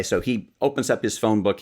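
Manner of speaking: normal talking voice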